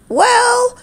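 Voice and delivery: high indignant "Well". A narrator's reading. Umpire offended at being asked who he is, indignant. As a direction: huff it out, offended. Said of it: "he replied indignantly".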